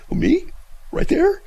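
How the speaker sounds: high, mock-innocent questions; playing dumb. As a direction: feign confusion to wind someone up. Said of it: "It was running him in circles".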